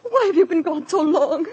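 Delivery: distraught, sobbing questions; tearful reproach. Acted. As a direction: plead through tears. Distressed woman reproaches late arrival.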